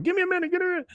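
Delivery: very high squeaky imitation of a kid; mock pinned-down kid. Kid being tickled and held down, high mock plea. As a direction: squeal it in a pretend kid's voice.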